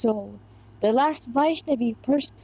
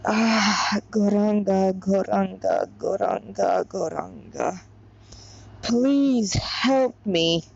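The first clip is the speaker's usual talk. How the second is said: slow, fading prayer to Gauranga; 3 semitones lower, slower than usual. A narrator's reading. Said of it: "she prayed with folded palms".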